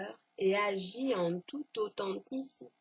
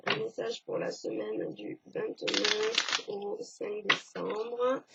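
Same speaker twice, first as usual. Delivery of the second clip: ordinary talk, nothing marked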